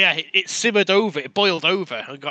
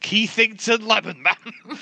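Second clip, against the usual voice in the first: slow, higher, stretched out mockingly; drawn-out mock name; 6 semitones higher, 3 dB louder, slower than usual. Echoing the mock name of Keith Lemon, slow and stretched. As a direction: savour each syllable as a joke name. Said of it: "mock name echoed".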